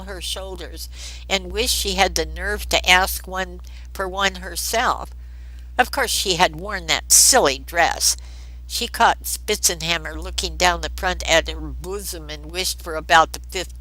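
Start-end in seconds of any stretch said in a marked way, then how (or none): none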